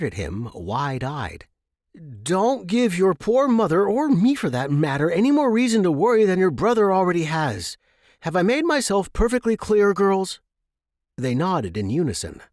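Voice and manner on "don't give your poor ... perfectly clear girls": higher, louder mock-strict lecture; Jeremy scolding the hatchlings like a father. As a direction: wag a finger at the little ones.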